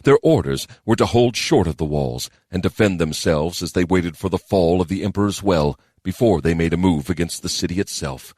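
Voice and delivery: usual speaking voice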